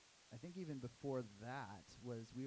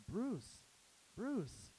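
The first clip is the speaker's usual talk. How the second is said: high calling across the street; calling out a name; 9 semitones higher, 5 dB louder than usual. Retold or imitated. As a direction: hail him from down the block.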